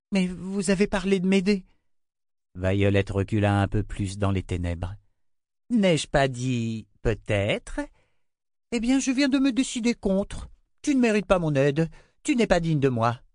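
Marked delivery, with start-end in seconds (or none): 0.1-1.6 put-on lightly raised girl's voice, apologetic; a child saying sorry to the queen; young girl Rachel. A narrator's reading. Girl apologizing to the queen, meek. 5.7-13.2 high, gloating queen's voice; cruel queen. A narrator's reading. Queen threatening the girl, gloating.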